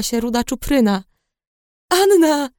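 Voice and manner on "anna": high-pitched cry of a name; excited cry. A narrator's reading. Friend rushes to embrace the patient, crying out. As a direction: cry out as you rush to hug her. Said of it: "krzyknęła i rzuciła się na mnie".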